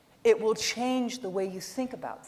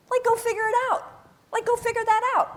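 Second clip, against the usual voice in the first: exasperated; 5 dB louder, faster than usual. Real, not acted. Exasperated advice to kids.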